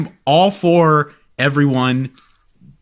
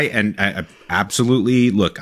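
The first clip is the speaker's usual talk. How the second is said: usual speaking voice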